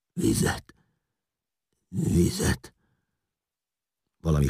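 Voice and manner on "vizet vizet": voiceless, croaking plea; rasping death rattle. A narrator's reading. Crucified man begs for water, rasping and croaking. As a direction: croak it out with failing strength. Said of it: "és hörgött".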